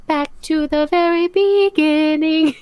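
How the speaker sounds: mock-epic repeat of the line; dramatic announcement. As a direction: stretch it out like a grand narrator. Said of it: "I gotta go back to the very beginning".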